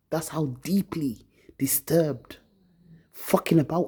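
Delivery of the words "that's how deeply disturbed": slow, hushed whisper. Real, not acted. Confiding to viewers how disturbed she is, whispering. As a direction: breathe it out, voice dropped away. After talking of weeping soul.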